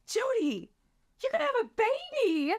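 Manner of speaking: squealing high with delight; excited friend. Retold or imitated. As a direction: gush it like she just heard the best news. Friend thrilled about the baby.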